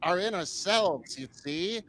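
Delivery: mid-Atlantic accent, mock spiritual sermon; Marianne Williamson impression. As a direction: lay on the old-movie mid-Atlantic accent, preaching the inner self. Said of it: "I might do an impression".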